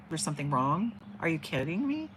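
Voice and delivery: ordinary talk, nothing marked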